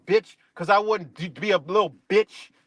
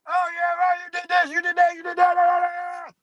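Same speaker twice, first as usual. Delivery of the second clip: mocking critic; 10 semitones higher than usual.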